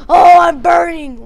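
loud, high play-acted yell; hammed-up shout of alarm; mock burning panic. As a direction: cry out as a burning figure. Figure burning in play story.

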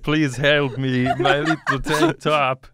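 loud, slow, pleading in a hammed-up French accent. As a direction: beg in a cartoon French accent. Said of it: "This is my French accent".

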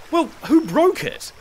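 high-pitched, pressing question; impatient question. A narrator's reading. Master presses slave for culprit, impatient.

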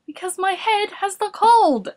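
high, odd character voice; silly patient's voice. A narrator's reading. Morris explaining his covered head, silly high voice. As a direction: pitch it well up, as a simple-minded patient. Morris with a cold answers Boris.

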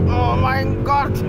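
startled, drawn-out cry; shocked exclamation. Real, not acted. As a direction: blurt it out in shock. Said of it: "Das Oh mein Gott schneiden wir aber raus".

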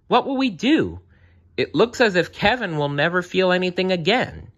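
high, distressed storybook bear; worried bear. A narrator's reading.

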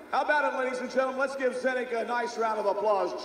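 big arena announcer call. Real, not acted. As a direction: hype the crowd for applause. Announcer calls for applause.

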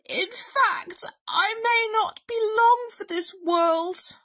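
high, melodramatic invalid bear; self-pitying, hammed-up bear; huffing bear. A narrator's reading. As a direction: huff as if at death's door. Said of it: "Bear huffed".

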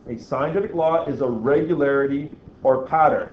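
ordinary delivery

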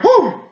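high exhaled "whew"; whew of relief. Retold or imitated. Soldier retelling the moment he held fire, relieved whew. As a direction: blow out a retold sigh of relief. Relief after not firing, retold.

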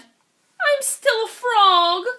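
slow, crying lament; tearful disappointment. Acted. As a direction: wail it slowly.

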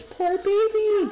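high, slow pitying croon; pitying coo. Real, not acted. Streamer crooning sympathy for her pregnant Sim.

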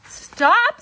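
high loud scream. Acted. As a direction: scream to cut him off. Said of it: "screamed Wilbur".

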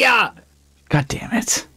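slow hushed despairing curse; whispered curse. Real, not acted. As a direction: breathe it out in defeat. Teammate dies too.

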